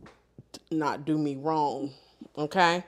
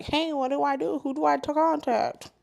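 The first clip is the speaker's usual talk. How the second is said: higher-pitched puzzled questions; lightly mock a lost caller, pitched up; confused caller; 8 semitones higher than usual. Caller turning up before office hours, mock confused.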